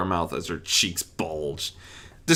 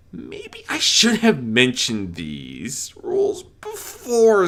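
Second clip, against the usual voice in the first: sly whisper; 5 semitones higher, 4 dB louder, slower than usual. Acted. Discord's sly aside.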